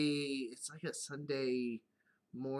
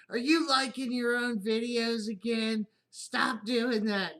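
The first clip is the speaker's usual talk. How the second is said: higher, louder, slow mock scold; daughter yelling; 8 semitones higher, 7 dB louder, slower than usual. Daughter scolding dad for liking his own videos, loud mock yell. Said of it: "she'll yell at me".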